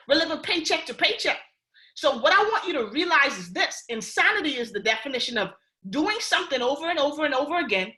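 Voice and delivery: usual speaking voice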